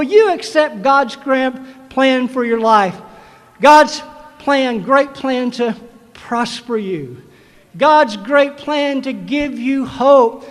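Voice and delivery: full-voiced sermon climax; preaching at full force. Real, not acted. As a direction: proclaim it to the graduates.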